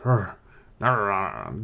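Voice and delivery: imitated dog growling; voiced rolling snarl. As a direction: growl in warning like a guard dog. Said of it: "and the dog is like".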